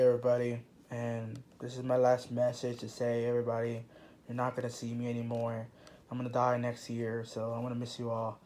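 low quiet doomed character; low mournful voice. Pretend. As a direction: sign off like a fading ghost.

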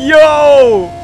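high, loud stunned yell; surprised shout. Real, not acted. Friend's car pulls away, shocked shout. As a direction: blurt it out in shock.

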